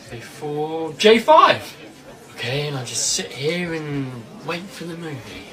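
normal talking voice

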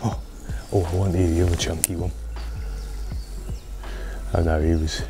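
normal talking voice

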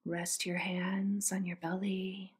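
normal talking voice

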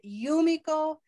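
Yumiko with a big, high first syllable; stress on first syllable. Shown as a demonstration. Teacher offering name choices, Yumiko stressed on the first syllable. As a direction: punch the YU in Yumiko. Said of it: "we have to pick one syllable".

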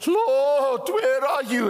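loud, high plea to God; crying out. Acted. Deceived people cry to God in calamity, crying out. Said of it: "then they cry and says".

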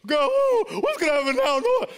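very high, loud anguished cry; despairing wail. Retold or imitated. Player cries out during the strike, wailing.

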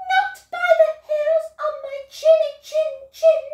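high, fast, loud piggy squeak; squeaky pigs. Acted. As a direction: squeal it back in tiny pig voices. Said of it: "cried the two little pigs".

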